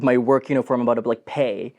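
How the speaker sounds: ordinary talk, nothing marked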